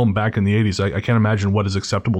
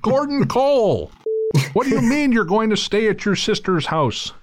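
shouting Gordon Cole; 11 semitones higher than usual. Acted.

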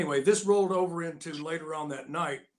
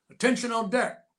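higher, louder military call; called to attention; 7 semitones higher, 4 dB louder than usual. Retold or imitated. Sailor announcing the Admiral at the fountain, loud call. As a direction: bark the command to attention.

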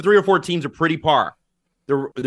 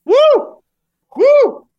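high whooping yell from the heat; loud whoop; 9 dB louder than usual. Real, not acted. Reacting to hot sauce.

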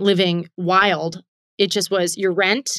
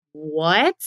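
drawn-out rising what; baffled, stretched-out question; 3 semitones lower than usual. Acted. Baffled reaction to managers' demands.

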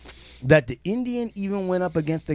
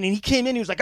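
ordinary delivery

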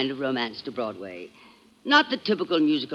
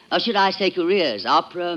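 normal talking voice

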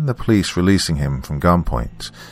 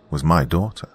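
plain reading voice

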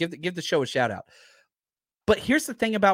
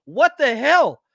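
exasperated outburst; 7 semitones higher, 8 dB louder, slower than usual. Real, not acted. Exasperated outburst mid rant.

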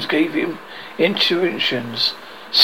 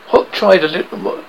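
usual speaking voice

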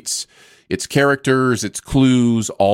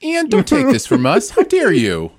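pleading much higher, hammed-up; mock desperate plea; 11 semitones higher than usual. Begging his cohost to spare their town, mock-desperate high plea. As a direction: beg Ian not to take it away, in jest.

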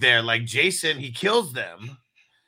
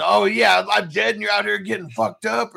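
raised, louder mock complaint; retold character's complaint; 5 semitones higher, 4 dB louder than usual. Dead boyfriend confronting the girl in the dream, mock indignant. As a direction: put on the aggrieved character, pitched up.

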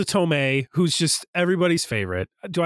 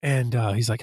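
ordinary talk

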